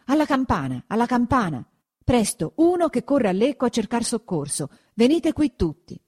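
high-pitched rallying commands; urgent orders. A narrator's reading. Consul to the crowd, sending for help, urgent orders.

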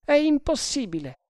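very high, firm refusal; refusing sergeant. Acted. Sergeant rejecting prisoner's plea for mercy, firm.

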